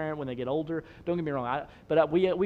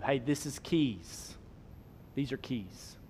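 slow, simple naming for someone forgetful; patient reminding; 6 dB quieter, much slower than usual. Pretend. Grown daughter reminds her forgetful parent, slowly and patiently. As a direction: lightly act out a carer explaining slowly. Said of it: "she'll be reminding you".

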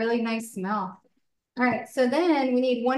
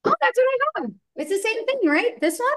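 suddenly much higher, pleasantly surprised; 11 semitones higher, faster than usual. Real, not acted. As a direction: pitch jumps way up, delighted surprise. Surprised they bought the same mix.

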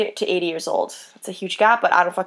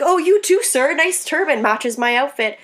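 mock-gushing reply, pitched up; sarcastic compliment; 4 semitones higher than usual. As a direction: imitate a sweetly sarcastic comeback, higher voice. Imagined sarcastic reply to catcaller.

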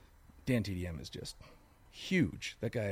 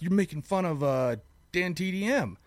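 higher-pitched mock indignant child; imitating offended kids; 8 semitones higher, 3 dB louder than usual. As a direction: accuse like an offended kid, slowing on the name. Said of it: "They got mad at me".

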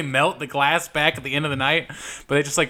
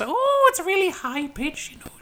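Cork accent. Shown as a demonstration. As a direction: pitch a mock Cork accent way up. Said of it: "Cork, Cork has".

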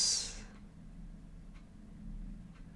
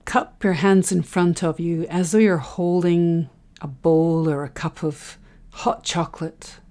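normal talking voice

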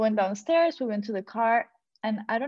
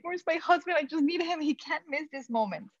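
anxious plea; 7 semitones higher than usual. Retold or imitated. Pleading for husband.

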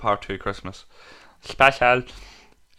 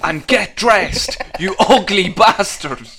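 priest voice; 6 semitones higher, 6 dB louder, much slower than usual. Retold or imitated. Priest voiced, slow loud.

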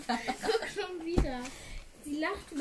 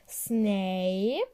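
ordinary talk

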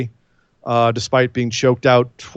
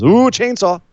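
higher, sarcastic "ooh"; mock awe; 7 semitones higher than usual. Mocking a chainsaw that failed to intimidate, mock awe. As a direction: pretend to be scared, pitched up.

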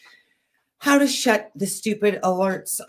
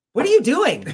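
higher, louder irritated challenge to mom; irritated question; 11 semitones higher, 5 dB louder than usual. Retold or imitated. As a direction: snap it at her in mock irritation. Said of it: "And I said to her".

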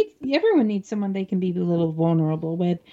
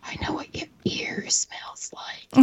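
heavy breathing phone whisper; 2 semitones higher than usual. Pretend. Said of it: "I took it too far".

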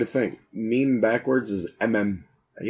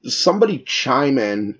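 ordinary delivery

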